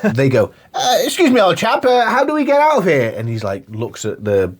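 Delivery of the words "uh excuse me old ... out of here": high-pitched caricature of an English chap; mock English old-chap voice; English chap accent. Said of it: "the English guys are like".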